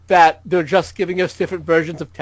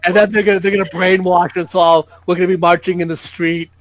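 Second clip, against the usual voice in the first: ordinary delivery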